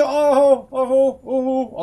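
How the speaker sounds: high, loud panicked "oh" cries; loud wordless cries of fright; startled whoops. Real, not acted. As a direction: yelp repeatedly in alarm. Giant creature suddenly close.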